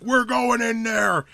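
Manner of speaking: high, slow, drawn-out declamation; theatrical screaming professor. Retold or imitated. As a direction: imitate a professor's Shakespearean battle cry. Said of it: "he would just start screaming like Shakespeare".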